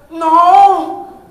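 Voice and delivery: a loud, high burst; excited. Real, not acted. Pastor answering his own question, loud and excited. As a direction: shout it out with joy. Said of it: "am I getting overexcited?".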